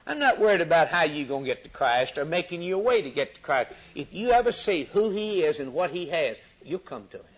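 ordinary talk

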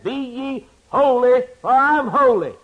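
loud divine command. Retold or imitated. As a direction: proclaim it like a command from on high. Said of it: "He said".

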